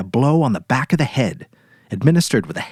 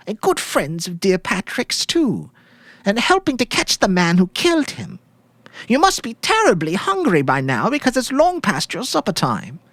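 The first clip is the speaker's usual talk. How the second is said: sweet widow's voice; 12 semitones higher than usual. Acted. Widow coaxing the policemen to eat the lamb, high and sugary. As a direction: play the grieving wife offering supper with false sweetness.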